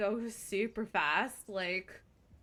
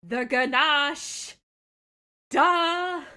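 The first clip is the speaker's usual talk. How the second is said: mock obvious duh; 5 semitones higher, 11 dB louder, much slower than usual. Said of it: "I would be like".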